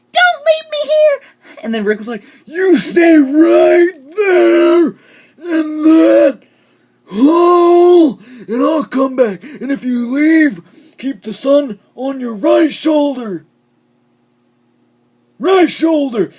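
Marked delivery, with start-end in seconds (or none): 0.1-1.6 very high, slow, loud mock plea; hammed-up squeaky begging; mock Sophia pleading. Sophia begging Rick not to leave, very high mocking voice. 2.4-16.3 loud, high, drawn-out overacted orders; hammy shout, stretching the words; mock Rick shouting. Rick telling Sophia to stay put, in an exaggerated slow shout.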